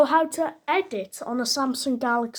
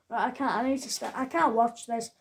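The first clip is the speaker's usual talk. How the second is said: usual speaking voice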